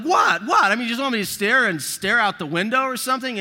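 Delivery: mock husband, fed up and loud; defensive outburst. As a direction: snap back, defending yourself.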